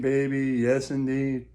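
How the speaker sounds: usual speaking voice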